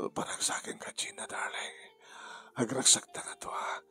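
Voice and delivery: voiceless, soft loving whisper; intimate whisper. Acted. Gina whispering to Daniel in the shower. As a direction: murmur into his ear.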